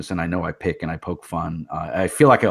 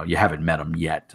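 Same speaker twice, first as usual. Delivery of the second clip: usual speaking voice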